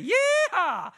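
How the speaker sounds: loud, drawn-out cowboy whoop; excited Texan yell. Shown as a demonstration. Teaching orphans what Texans yell, excited holler. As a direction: holler it slow and high. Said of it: "when we get excited about something".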